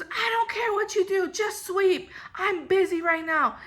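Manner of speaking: high-pitched imitation of an exasperated, yelling boss; mock yelling. Busy manager to worker asking about sweeping, mock yelling. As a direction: pitch it way up, dismissive retold yell. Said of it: "she's yelling at me".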